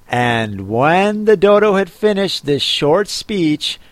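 plain reading voice

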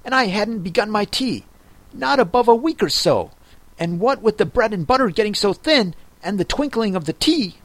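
fast, high, nervous rush; nervous babbling. Acted. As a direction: gabble it out in fear. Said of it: "in a trembling voice".

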